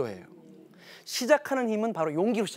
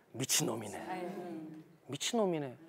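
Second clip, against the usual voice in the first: muttered scoff; 4 semitones lower, 10 dB quieter than usual. Retold or imitated. Nobles scoffing at the king.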